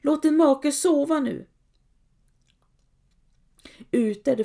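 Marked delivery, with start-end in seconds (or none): 0.0-1.5 high, loud scolding call; sharp call. A narrator's reading. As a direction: snap at her across the room. Woman scolding in opening quote. (skarpt, högt tillrättavisande)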